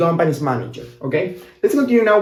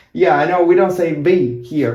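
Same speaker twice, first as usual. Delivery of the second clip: ordinary talk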